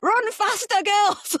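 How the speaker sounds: high, loud yelling PE teacher; barking gym coach. Retold or imitated. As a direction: bark it like a school PE teacher. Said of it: "my P E teacher's voice in my head going".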